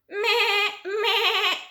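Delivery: high bleating goat. A narrator's reading. Goat bleats from his mouth.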